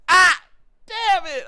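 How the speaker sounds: very high, loud cry of dismay; exasperated outburst. Acted. Man reacting to his friend's awkward remark, exasperated outburst. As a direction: squeal it out in comic exasperation.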